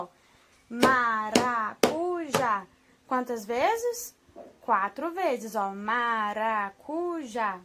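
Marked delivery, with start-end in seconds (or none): 0.7-2.6 "Maracujá" very slow and loud, in four beats; sílabas com palmas. Shown as a demonstration. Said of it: "Vamos bater palma". 5.7-7.6 "Maracujá" very slow, split into four syllables; separando as sílabas. Shown as a demonstration. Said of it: "Nós falamos ela quatro vezes".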